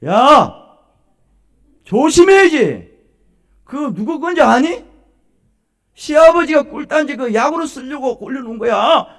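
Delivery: loud, high-pitched scolding outburst; acted shout at a careless daughter-in-law; angry mother-in-law. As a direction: start with a yell, then keep berating. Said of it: "화가 나서".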